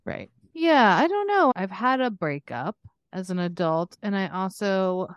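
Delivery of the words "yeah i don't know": ordinary talk, nothing marked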